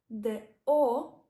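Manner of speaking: The O said loud to mark the stressed vowel; teacher stressing the O. Shown as a demonstration. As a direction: hit The O hard so learners hear the stress. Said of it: "at the end is stressed".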